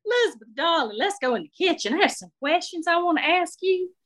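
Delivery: sweetly nosy Southern belle; Shirley approving Marie's shoes, Southern lady voice. Acted.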